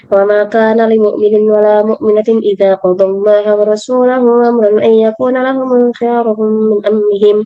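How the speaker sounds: usual speaking voice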